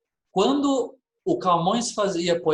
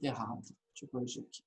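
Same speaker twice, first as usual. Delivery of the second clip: very soft and low, to yourself; muttered aside; 5 semitones lower, 15 dB quieter, faster than usual. Real, not acted. Teacher noticing a mistake on the board, muttering to self. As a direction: mutter the correction under your breath.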